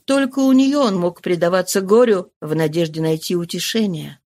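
plain reading voice

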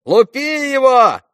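very high, slow, drawn-out battle yell; yelling boys. A narrator's reading. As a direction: egg him on at full shout. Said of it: "закричали все". (азартный мальчишеский крик)

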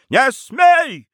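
high, loud sharp prohibition; alarmed shout. Acted. Mother rushing to stop her son's sword, shouting. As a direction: cry out to stop him dead.

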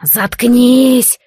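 loud, sharp hissed snap; piercing hiss. A narrator's reading. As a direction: spit the word out hissing. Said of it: "пронизывающим шипением выпалил". (пронзительное шипение)

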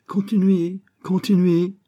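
put-on high, loud, heavy English accent; strong English accent. A narrator's reading. Stranger catching the intruder, strong English accent. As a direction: call it out in a thick English accent. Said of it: "avec un fort accent anglais".

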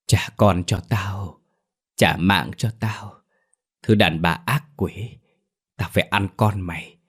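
lightly voiced ghost, dragging out the words; vengeful ghost. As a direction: draw it out like a haunting spirit. Maid mimics the ghost's words.

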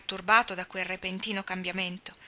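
ordinary delivery

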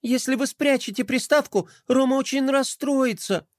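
high, pleading princess voice. Acted. Pinky begging the general to stop, pleading. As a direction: beg him not to.

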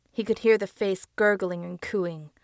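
usual speaking voice

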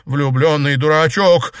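hysterical, mock sob. A narrator's reading. Sister lamenting her lovestruck dead brother, understated hysterical sob. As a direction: throw it out with a touch of hysteria. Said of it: "она истерично всхлипнула".

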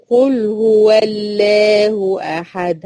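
قل هو الله أحد chanted very slowly; recited slowly. Shown as a demonstration. Teacher reciting al-Ikhlas to her students. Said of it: "تخيل كده بقول".

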